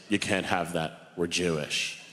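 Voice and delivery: put-on low, slow, stern old-man voice; imitating grandfather. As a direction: lay down the law like a grandfather. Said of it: "and he said".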